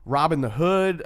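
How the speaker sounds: ordinary talk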